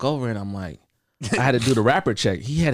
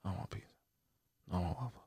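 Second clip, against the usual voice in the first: soft, unvoiced whispered inner debate; muttering to himself; 4 semitones lower, 16 dB quieter than usual. Tired Domino's worker debating waffles or pizza, whispering. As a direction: whisper it to yourself, undecided. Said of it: "I'm like".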